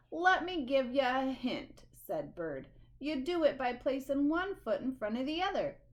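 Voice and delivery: loud, mocking hint; grumpy bird's sarcastic explanation. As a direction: talk down to the beaver like it's obvious. Bird being sarcastic.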